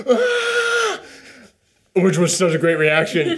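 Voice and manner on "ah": high yelp of fright; a sharp, high-pitched mock scream; startled yelp. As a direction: squeal like a startled moviegoer. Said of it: "the guy next to me just go".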